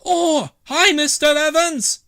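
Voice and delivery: put-on a cheeky kid teasing; cheeky boy. Norman popping round the corner to tease Mr Evans, cheeky. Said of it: "said Norman cheekily".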